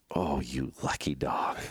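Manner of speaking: low, slow, lightly voiced envy; envious friend. Buddy Don hearing who's in the truck, low slow envy. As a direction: drag it out, grudging and impressed. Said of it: "And he's like".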